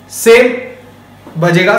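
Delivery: normal talking voice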